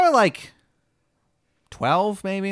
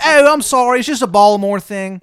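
loud, high, exaggerated Maryland twang; terrible Maryland accent; 9 semitones higher, 12 dB louder than usual. Baba Yaga greeting a newcomer, bad Maryland accent. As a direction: holler in a hammed-up Maryland accent. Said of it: "accent is like nails on a fucking chalkboard".

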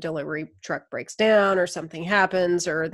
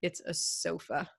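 laid-back shrug; 14 dB quieter, slower than usual. Retold or imitated. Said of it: "we're like".

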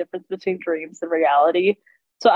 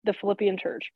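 usual speaking voice

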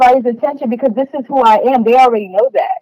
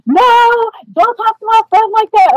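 high, protective friend's voice, much higher than her own; defensive friend; 13 semitones higher than usual. Retold or imitated. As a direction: snap back like a friend defending you. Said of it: "she's like".

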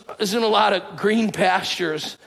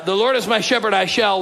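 put-on higher and louder, in old King James cadence; King James recitation; 3 semitones higher, 3 dB louder than usual. Shown as a demonstration. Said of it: "anybody know King Jimmy?".

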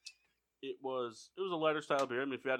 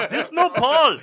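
high, loud, laughing broken English; laughing mock accent; 12 semitones higher, 21 dB louder, slower than usual. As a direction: squeal it through laughter. Prank call bit, laughing.

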